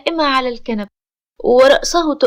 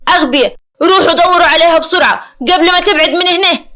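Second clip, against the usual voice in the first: loud, raised, fast scolding; furious; 4 semitones higher, 7 dB louder, faster than usual. A narrator's reading. Amer ordering guards to search for her, furious. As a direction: bawl them out and send them running. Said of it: "عامر بغضب شديد".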